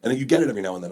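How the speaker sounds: ordinary talk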